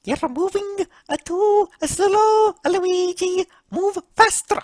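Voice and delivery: high, drawn-out nagging character voice; impatient Mario. Acted. Mario hurrying his brother in the game, impatient. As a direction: push him along in a high, pestering voice.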